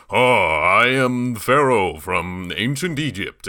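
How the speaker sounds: slow, grandly over-enunciated proclamation; the Pharaoh. Retold or imitated. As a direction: announce yourself with weird, pompous enunciation. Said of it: "all the weird enunciation that he does".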